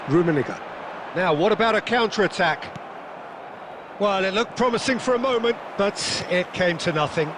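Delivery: lively live play-by-play, rising with the action; fast sports commentary. Acted. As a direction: call the match as it unfolds.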